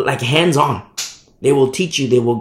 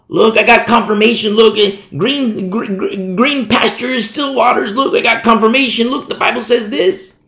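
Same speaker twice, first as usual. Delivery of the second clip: higher, insistent mock excitement; mock eager believer; 4 semitones higher than usual. Believer insists they got confirmation, mock eagerness. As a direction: imitate someone eagerly showing off proof.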